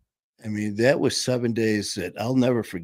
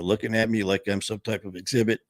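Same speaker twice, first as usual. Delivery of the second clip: ordinary talk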